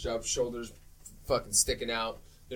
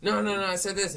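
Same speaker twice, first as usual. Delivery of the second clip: higher-pitched retold objection; mock protest; 7 semitones higher than usual. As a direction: imitate someone insisting on a correction. Voicing character in back-and-forth argument.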